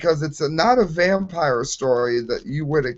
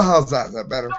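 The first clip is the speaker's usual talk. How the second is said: usual speaking voice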